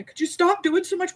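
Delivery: high imitation of his nagging line; mock husband. Retelling her husband's ironic nag, mock high voice. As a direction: lightly parody the husband's complaint.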